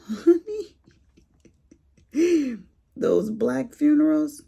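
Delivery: high, loud burst of gossipy excitement; excited exclamation. Real, not acted. Telling viewers about loud funerals, high excited burst. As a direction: swoop up high and loud, laughing.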